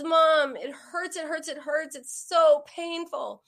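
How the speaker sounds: high wail with loud peaks; imitating crying child. Hurt six-year-old daughter to her mom, imitated wailing. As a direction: whine in pain, dragging the last words. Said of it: "And she's crying".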